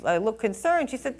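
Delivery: ordinary talk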